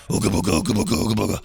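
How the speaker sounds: low mysterious gibberish chant; mock mysterious. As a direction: mimic a mysterious oddball, pitched well down. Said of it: "acting all mysterious and being like".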